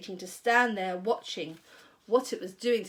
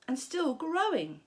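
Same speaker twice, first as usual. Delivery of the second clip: ordinary delivery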